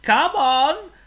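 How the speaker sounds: eager pig cry; pig calling out. Acted. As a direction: urge the bird on. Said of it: "cried Oink".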